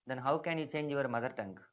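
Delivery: usual speaking voice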